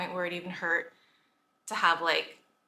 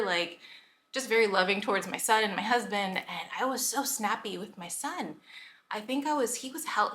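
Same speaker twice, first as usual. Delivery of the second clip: normal talking voice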